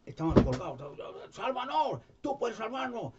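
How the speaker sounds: high, pleading cries; thieves crying out. Retold or imitated. Crucified thief shouting to Jesus, high and pleading. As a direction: beg to be saved, voice way up.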